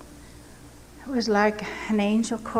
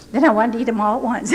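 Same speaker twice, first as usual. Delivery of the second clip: normal talking voice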